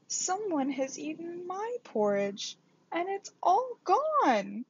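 a small bear whimpering over his empty bowl, pitched high; sobbing Baby Bear. A narrator's reading. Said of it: "sobbed Baby Bear". (Bébé Ours pleurniche d'une petite voix aiguë)

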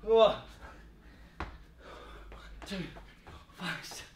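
breathless counting between reps; panting out the count mid-exercise; out of breath. Real, not acted. Counting reps mid-workout, gasping.

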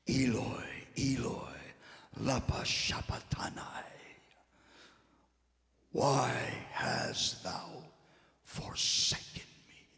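Jesus' cry rendered low and whispery; hushed retold yell. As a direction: breathe the cry out softly, with long pauses. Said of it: "he lets out a yell".

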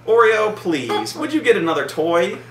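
pleading with dog. Real, not acted. Owner asking the dog to switch toys, pleading.

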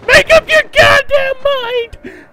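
high, strained outburst; exasperated yell. Real, not acted. Player fed up with changing directions, exasperated yell. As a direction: yell it high at someone dithering.

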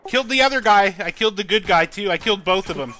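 loud, high excited callout; excited shout. Real, not acted. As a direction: shout the kills in triumph. Excited kill callout in game.

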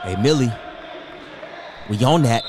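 loud callout; calling out. Real, not acted. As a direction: call out across the room. Calling out loudly.

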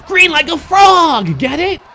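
high, stretched-out cheer; slow, high excited yell; excited shout. Real, not acted. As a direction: shout it high and draw out the words. Excited cheer during play.

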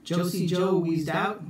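plain reading voice

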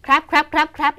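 CRAB repeated short with a P-like ending; quick CRAB, each cut off hard; crab sounding like crap. Shown as a demonstration. Teacher warning it resembles crap, short CRAB repeated. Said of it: "มันจะไปคล้ายๆกับคำว่า C R A P".

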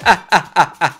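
botched villain cackle; failed mustache-twirling villain laugh; evil laugh. Pretend. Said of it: "esa risa malvada y me ha salido una mierda".

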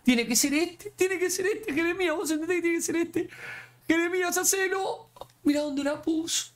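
very high, tense, pleading voice; desperate pleading. Real, not acted. Player begs his keeper Jeremías to save the penalty, frantic. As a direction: beg the goalkeeper to save it.

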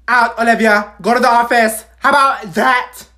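loud, high stern order; angry teacher. Acted. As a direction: throw her out of class. Teacher kicking student out.

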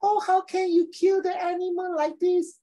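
very high, drawn-out mock wail; exaggerated high-pitched pleading, stretched out; vegan teacher. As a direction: impersonate her in a slow, squeaky falsetto. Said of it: "She talk like that, right?".

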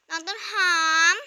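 loud rising call across the house. Acted. Big sister calls for little sister, loud call. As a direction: call her name loudly, voice lifting at the end.

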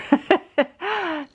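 bright burst of laughter; high laugh. Real, not acted. Laughs at the sheet.

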